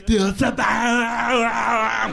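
put-on slow, high Austrian-accent yell, stretching bomb; Schwarzenegger yell. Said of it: "You ever hear Schwarzenegger yell".

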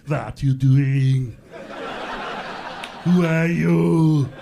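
slow, drawn-out action-star questioning; Arnold Schwarzenegger. Acted. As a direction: stretch each question out, heavy and forceful. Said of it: "That voice he uses when he asks a question".

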